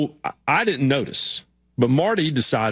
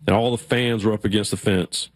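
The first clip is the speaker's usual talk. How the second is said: usual speaking voice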